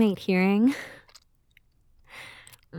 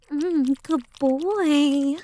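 slow, higher, drawn-out coo; teasing coo; 5 semitones higher, much slower than usual. Acted. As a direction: stretch it out high to tease. Teasing with the pet name.

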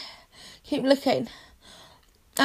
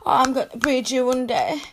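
ordinary talk

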